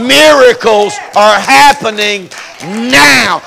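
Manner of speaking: Miracles are happening now shouted slowly and loud; shouted repeat. Shown as a demonstration. Preacher to congregation, second telling, slow loud shout. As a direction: blast the repeat over the first take. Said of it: "repeated louder".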